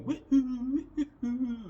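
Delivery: high, loud sung-out whooping; crowd woohoo. Retold or imitated. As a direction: imitate a crowd whooping after a ball. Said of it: "everyone's going".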